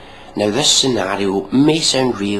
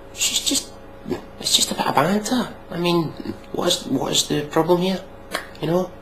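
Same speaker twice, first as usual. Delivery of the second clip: sarcastic, higher, playing dumb; mock the defence with a shrug in the voice; pitched-up sarcastic innocence; mock innocent; 11 semitones higher than usual. Sarcastically defending sexist banter.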